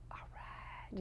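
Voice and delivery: soft whispered All right, quietly touched. Retold or imitated. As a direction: breathe it, barely voiced. Moved by child's kind words.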